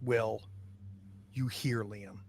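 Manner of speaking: ordinary talk, nothing marked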